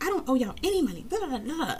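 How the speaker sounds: high, quick put-on indignant voice; mock indignant patron. Patron refusing to pay the library, mocked high and fast. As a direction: ape her outrage up high and fast. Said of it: "She's like".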